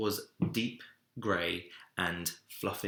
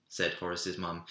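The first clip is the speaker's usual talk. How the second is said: ordinary delivery, nothing marked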